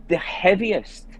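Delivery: ordinary talk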